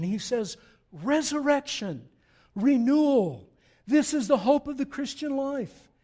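loud, high-pitched pulpit preaching; full-voiced sermon climax; preaching at full force. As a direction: drive it home to the congregation.

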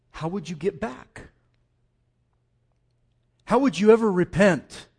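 ordinary delivery

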